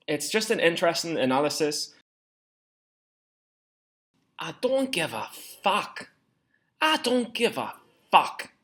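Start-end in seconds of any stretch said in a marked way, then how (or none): 4.4-6.1 put-on a slow first go at a Scottish accent. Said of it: "me Scottish accent's not that good". 6.8-8.5 put-on higher, slower Scottish accent take. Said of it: "me Scottish accent's not that good".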